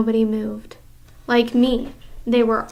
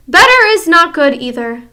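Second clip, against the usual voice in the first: loud, higher witch shriek; 6 semitones higher, 9 dB louder than usual. Acted. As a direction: shriek the rebuke. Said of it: "shrieked the Grand High Witch".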